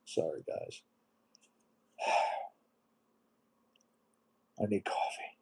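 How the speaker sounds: quiet, halting, with heavy sighs; real emotion, voice dropping away between long pauses; choked up, emotional. Real, not acted. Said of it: "I'm getting emotional now".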